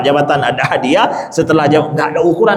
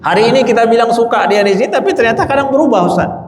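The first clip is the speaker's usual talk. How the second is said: doubting congregant; 6 semitones higher than usual. Retold or imitated. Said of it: "Ada yang bilang begini".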